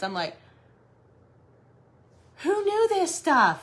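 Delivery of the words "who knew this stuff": amazed. Retold or imitated. Reacting to snake news.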